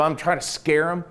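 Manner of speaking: ordinary talk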